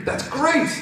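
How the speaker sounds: very high-pitched, hopeful squeal; elated. Acted. Rainbow Dash hearing of an alibi, elated. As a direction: cut in with delight.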